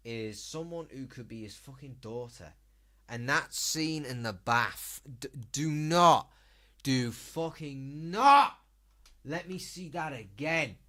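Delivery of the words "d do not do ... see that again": 1 loud disgusted plea. Real, not acted. Disgusted at the bath scene.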